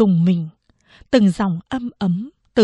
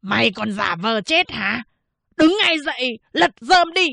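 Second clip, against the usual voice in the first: hoarse yelling; 3 semitones higher than usual. A narrator's reading. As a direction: shout orders at the wife, pitch rising. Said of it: "gào lên bằng cái giọng khàn khàn". (gào lên khàn khàn)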